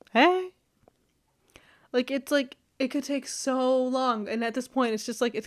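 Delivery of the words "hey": high, breezy hey; casual greeting. Retold or imitated. Imitating herself greeting her crush, high and breezy. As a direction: greet him lightly. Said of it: "And I'd be like".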